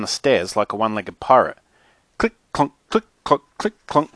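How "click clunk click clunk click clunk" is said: higher, staccato sound-effect words; imitated hobbling steps. A narrator's reading. Mackenzie hobbles down the stairs, imitated click-clunk footsteps. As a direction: tick out each step separately.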